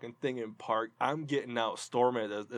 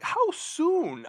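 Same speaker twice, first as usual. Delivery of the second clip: incredulous. Retold or imitated. Incredulous retort to old man.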